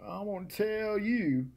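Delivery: slow, drawn-out lightly voiced Dr Phil; mock Doctor Phil. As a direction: lecture slowly like a TV guru. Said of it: "He's an idiot".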